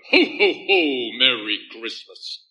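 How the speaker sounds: slow mock ho-ho-ho of a bouncing toy Santa; imitating a wind-up Santa toy; toy Father Christmas. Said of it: "and it would bounce on the floor and go".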